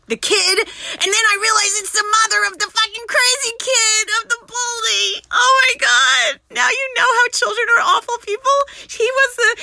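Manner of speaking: high, loud, incredulous rant; worked-up outburst. Real, not acted. Telling Brenda whose mother it was, worked-up outburst. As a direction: let it rise into an appalled yell. Heated retelling of a shocking realization.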